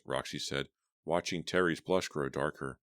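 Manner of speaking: ordinary delivery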